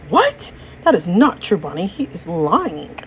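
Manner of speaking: indignant, stretched-out disbelief; shocked denial. Acted. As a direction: react in shock and refuse to believe it. Acted shocked denial of betrayal.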